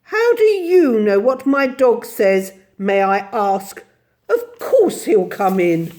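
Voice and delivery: loud, haughty challenge; indignant, superior lady. A narrator's reading. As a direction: put the servant in her place. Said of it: "regarded her haughtily".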